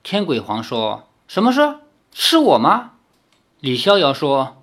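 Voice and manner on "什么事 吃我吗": curious question. Acted. Demon asks eagerly.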